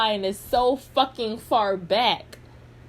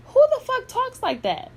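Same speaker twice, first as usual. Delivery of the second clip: very high, louder mock outrage; outraged disbelief; 5 dB louder than usual. Vlogger repeating a man's shocked reaction, high loud disbelief. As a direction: exclaim it way up high, imitating someone appalled.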